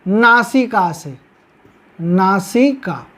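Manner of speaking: slow low teacher emphasis; slow emphatic term. Shown as a demonstration. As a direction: stress नासिका so students remember it. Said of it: "उच्चारण है वो कहाँ से आएगा".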